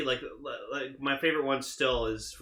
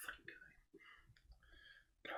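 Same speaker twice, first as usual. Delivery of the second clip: very slow, faint whisper; imitating a private, whispered grumble; muttered under breath; 28 dB quieter, much slower than usual. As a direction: breathe the curse out, barely audible. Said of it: "he'd mutter Guy's name under his breath".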